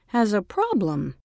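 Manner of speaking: plain reading voice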